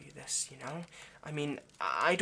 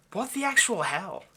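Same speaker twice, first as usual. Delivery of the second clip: louder, higher indignant burst; exasperated outburst; 5 semitones higher, 7 dB louder than usual. Acted. Vampire rants to the captive about the Scottish stalker, indignant and loud. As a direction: throw your hands up in outrage.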